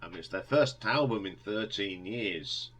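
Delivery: ordinary delivery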